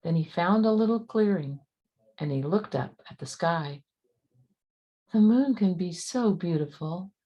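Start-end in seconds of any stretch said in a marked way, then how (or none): none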